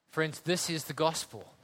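usual speaking voice

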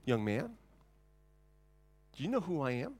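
soft, pointed question with a rising end; indignant old lady. Acted. Old lady challenging the deacon, quietly indignant. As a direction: quietly pull rank, pausing after the address. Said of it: "that lady looked at him, she goes".

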